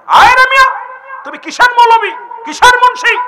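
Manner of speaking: high, loud mock telling-off; shouted rebuke at an underling; scolding. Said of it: "ধমক দিয়া দিই".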